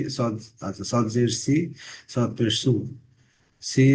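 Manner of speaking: ordinary talk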